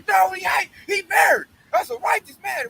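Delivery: imitating an outraged grandmother; mock indignant denial. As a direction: cry out in scandalized protest. Voicing grandma's indignant defense of pastor.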